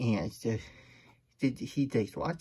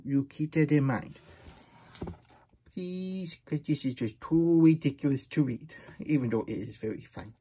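plain reading voice

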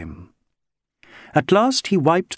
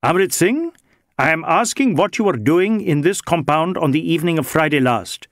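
higher-pitched, insistent interrogator; brisk inspector; 5 semitones higher than usual. Acted. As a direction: press the question with formal authority.

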